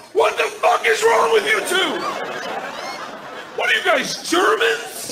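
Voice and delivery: high-pitched outraged yell; outraged dad. Retold or imitated. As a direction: scold the kids in shrieking disbelief. Father yelling at his two daughters.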